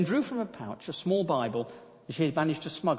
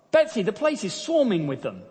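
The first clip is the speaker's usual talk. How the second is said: alarmed; 7 semitones higher, 6 dB louder than usual. Acted. Corrie alarmed at fleas.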